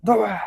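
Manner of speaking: high-pitched comic crash yelp; mock collision cry. Streamer imagines crashing into a man on a corner, comic yelp. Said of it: "おっさんとかとぶつかって".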